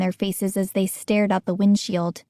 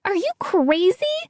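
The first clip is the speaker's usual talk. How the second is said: cried out; 9 semitones higher than usual. A narrator's reading. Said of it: "I cried".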